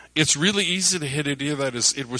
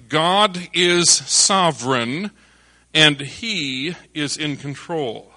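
usual speaking voice

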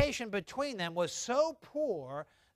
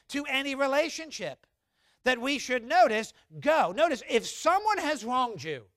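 building preaching; 7 semitones higher, 5 dB louder than usual. Acted.

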